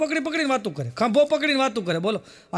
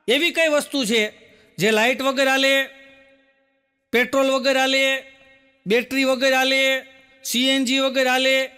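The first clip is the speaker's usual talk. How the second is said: slow riddle. Retold or imitated. Man posing riddle to old uncle.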